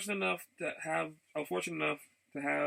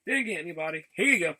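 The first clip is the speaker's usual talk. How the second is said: mock dismissive handoff; 4 semitones higher, 7 dB louder than usual. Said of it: "once I was like".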